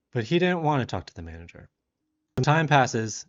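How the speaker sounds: ordinary delivery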